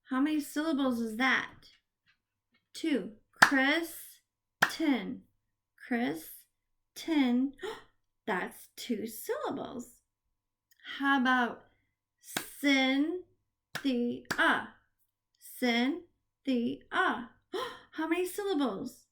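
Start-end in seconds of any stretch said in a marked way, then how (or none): 3.4-7.5 Kristen broken into "Kris", pause, "ten"; each syllable of Kristen set apart. Shown as a demonstration. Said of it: "we are gonna clap the syllable". 12.6-16.8 Cynthia split into "Cyn", "thi", "a"; small gaps inside Cynthia; syllable by syllable. Shown as a demonstration. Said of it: "we are gonna clap the syllable".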